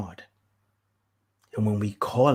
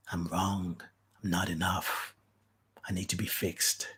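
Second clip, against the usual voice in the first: quiet inner voice; 4 semitones lower, 9 dB quieter than usual. Retold or imitated. Voicing inner self-blame.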